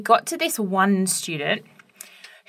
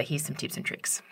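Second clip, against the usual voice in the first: whispered aside; 4 semitones lower, 12 dB quieter than usual. Retold or imitated. Colleague confides warning about class.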